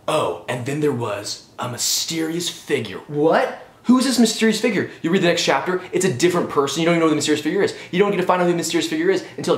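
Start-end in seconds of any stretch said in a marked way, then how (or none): 0.1-3.0 slow, drawn-out suspense storyteller; mock cliffhanger narration. Reviewer parodying a chapter ending, slow mock-suspense narration. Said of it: "Every chapter, it's like". 3.1-4.9 high-pitched, puzzled reader; mock bafflement. Reviewer acting out a hooked reader, mock bafflement.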